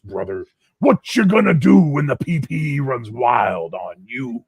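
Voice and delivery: loud, high wrestling promo shout, brother; hammed-up Hulk Hogan hype to King Eric. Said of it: "Hulk Hogan".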